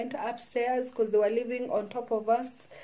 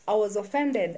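ordinary talk, nothing marked

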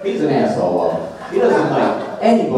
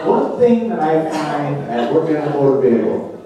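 usual speaking voice

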